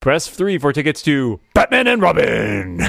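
lightly voiced announcer reading the menu; a hint of the automated movie-line voice; mock phone announcer; Movie Phone guy. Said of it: "hear that guy pronounce".

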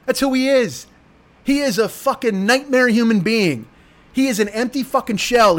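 usual speaking voice

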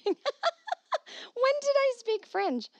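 high, slow laughing disbelief; incredulous laugh. Real, not acted. Laughing at praise for her French. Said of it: "hearing you speak French is so refreshing".

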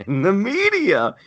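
high, loud, strained repeat; exasperated emphasis. Real, not acted. Host ranting about coverage, exasperated emphasis.

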